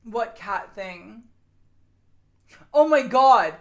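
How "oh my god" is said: excited exclaim. Real, not acted. Remembering the cat story.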